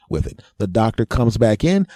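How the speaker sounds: ordinary delivery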